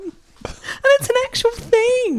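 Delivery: usual speaking voice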